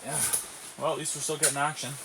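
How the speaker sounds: usual speaking voice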